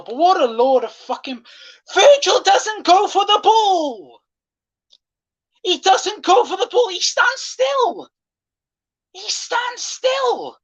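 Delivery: very high, slow, incredulous cry; shrill outraged rant. Real, not acted. Fan ranting about Virgil standing still, very high outraged cry. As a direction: screech your disbelief at the defending.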